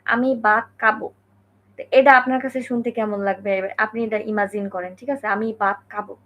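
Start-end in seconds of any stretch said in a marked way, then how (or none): 0.1-1.1 foreigner's Japanese-tinged Bengali with hard unaspirated consonants; Japanese-accented Bengali. Acted. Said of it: "ধরেন একজন Japanese Bangla বলতেছে". 5.3-6.1 foreigner's Japanese-tinged Bengali with hard unaspirated consonants; Japanese-accented Bengali. Acted. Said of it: "ধরেন একজন Japanese Bangla বলতেছে".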